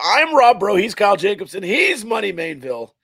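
loud, high announcer intro; show intro. Acted. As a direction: hype up the show opening.

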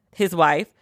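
normal talking voice

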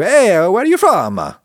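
high-pitched cartoon Italian accent; Mario accent. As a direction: put on a hammy Mario-style Italian accent. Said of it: "Sorry for the Mario accent".

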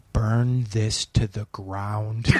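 low, slow sinister voice in the head; slow, low and menacing, like a demon whispering; inner dark voice. Retold or imitated. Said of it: "This voice in my head goes".

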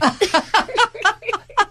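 high delighted laugh; burst of laughter. Real, not acted. Delighted laugh at celebrity story.

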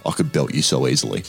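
lightly voiced drunk bloke threatening; mock menacing threat. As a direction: mutter it like a pub bloke picking a fight. Same bloke turning menacing.

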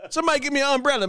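usual speaking voice

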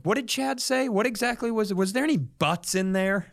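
frantic, high-pitched nitpicking; frantic high questioning. Acted. Cycling through loopholes, frantic.

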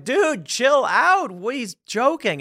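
drawn-out, high, loud 'dude' plea; mock protest. Party guys telling a screaming woman to relax, mock protest. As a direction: stretch it out high, imitating incredulous buddies. Friends protest at her freakout.

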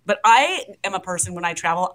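normal talking voice

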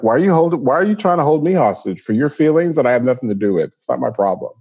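staff voice. Retold or imitated.

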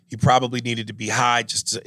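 normal talking voice

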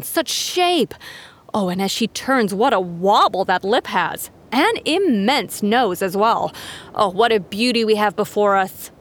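slow, gushing pageant host; pageant announcer. Acted. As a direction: gush over every contestant. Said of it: "the announcer described each camel".

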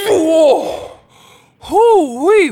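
high, loud impressed exclaim; impressed wolf. Acted. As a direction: whoop in amazement. Wolf strains at brick house.

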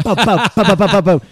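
loud, high bum-bum-bum zapping sounds; mock channel-buzzing noise. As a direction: chant the channel flips loudly. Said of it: "And I was like".

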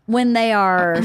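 plain reading voice

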